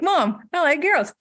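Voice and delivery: telling mom hopefully, in a lightly voiced younger self; young self, blurted. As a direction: blurt it out like an eager teen. Coming out to mom.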